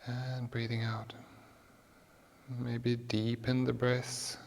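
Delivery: guided meditation. Acted. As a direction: guide the breath gently. Guided breathing cues.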